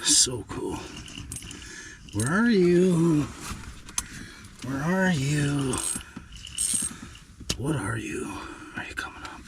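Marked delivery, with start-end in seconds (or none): 2.1-5.8 drawn-out coaxing. Pretend. Calling softly for a fish. 7.6-9.4 hushed, breath-only questions; whispered coaxing. Pretend. Angler coaxing a fish to come up, whispering.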